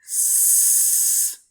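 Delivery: "S" held as a long hiss; unvoiced S sound. Shown as a demonstration. Teacher letting students check S, long voiceless hiss.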